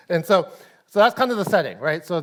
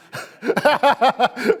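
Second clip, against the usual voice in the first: high laugh; 8 semitones higher, 6 dB louder than usual. Real, not acted. Laughs at introverts liking lockdown.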